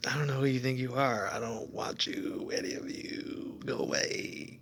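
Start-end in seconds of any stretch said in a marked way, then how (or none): 1.4-4.6 a softer, breathy whisper, slowed. Real, not acted. Fed up with people, he whispers them away. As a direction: hiss it under your breath at them. Muttering to people who bother him.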